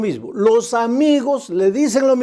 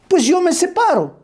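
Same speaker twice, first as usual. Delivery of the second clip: put-on defiant young woman; 9 semitones higher than usual. Pastor voices the defiant girl.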